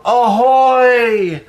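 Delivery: high, drawn-out call from below; yelled pirate hail. A narrator's reading. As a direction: call up to the fort, pitched high. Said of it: "heard Dante yell out".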